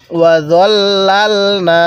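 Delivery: long drawn-out chanted recitation, every vowel stretched; slow Quran recitation. Shown as a demonstration. Teacher models the verse for students, slow drawn chant. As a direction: intone it slowly, as the teacher's model reading. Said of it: "কিভাবে পড়ব?".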